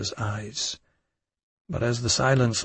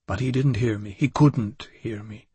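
ordinary delivery

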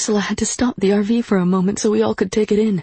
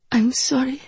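small, high-pitched apology; tearful apology; 6 semitones higher than usual. A narrator's reading. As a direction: apologize as if near tears. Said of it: "Her cheeks were wet then".

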